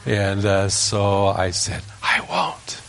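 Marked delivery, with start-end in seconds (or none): 2.0-2.8 high, whispered promise. Retold or imitated. Little boy promising his brother, high whisper. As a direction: whisper it back, high like a small child. Three-year-old whispering back.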